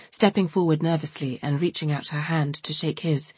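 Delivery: usual speaking voice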